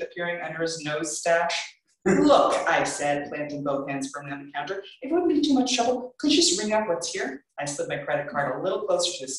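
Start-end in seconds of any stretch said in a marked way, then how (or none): 2.1-2.5 loud, high sharp "Look"; exasperated. Acted. Girl cutting off the clerk, loud and exasperated. 5.0-7.5 high, fast overpolite request; sweet on top, fed up underneath; strained politeness. Acted. Girl forcing politeness at the clerk, strained.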